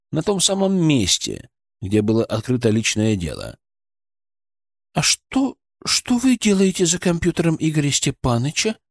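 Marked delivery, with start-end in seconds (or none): 4.9-8.8 suspicious. A narrator's reading. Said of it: "появились стальные нотки".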